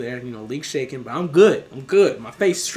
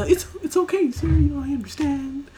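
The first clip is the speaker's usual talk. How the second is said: high-pitched, meek forgiveness; small, high voice trying to placate; mock nervous reassurance; 10 semitones higher than usual. Worker forgiving the rude customer, mock nervous reassurance.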